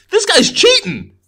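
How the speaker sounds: high, loud, slow shout of delighted outrage; excited accusation. Real, not acted. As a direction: call it out like you caught him red-handed. Spotting a cheating robot on the label.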